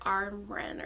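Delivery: Amren said AR-mren; differently pronounced Amren. Retold or imitated. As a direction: open AH-R sound starting Amren. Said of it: "I heard someone pronounce it differently".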